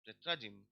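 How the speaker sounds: चैत्राजिम ending in a short, weak "jim"; reduced unstressed ending. Shown as a demonstration. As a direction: model चैत्राजिम with its last vowel unstressed. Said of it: "unstressed है, stress यहाँ पे है". (चैत्राजिम का आख़िरी "जिम" छोटा और हल्का)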